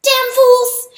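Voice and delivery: high, loud enraged mouse squeak; furious squeak. A narrator's reading. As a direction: spit it out in a tiny fury. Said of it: "he squeaked".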